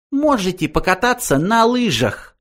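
high, mocking suggestion; sarcastic. A narrator's reading. Benji mocking the old man about skiing. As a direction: needle him with a sneer. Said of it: "язвительно предложил Бенджи".

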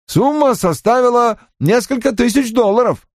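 high, eager-to-justify report; offended young subordinate reporting. Acted. Voices Johnny reporting. (высоким голосом обиженного подчинённого)

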